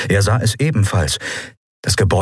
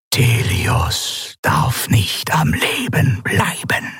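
slow, eerie alien whisper; 5 semitones higher, much slower than usual. A narrator's reading. The masked governor whispers a death order to the admiral. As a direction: breathe the words out slowly, barely voiced. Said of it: "flüsterte Sylravan".

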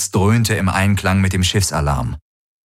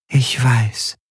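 lightly voiced figure; 4 semitones higher than usual. A narrator's reading. The figure answers Garland.

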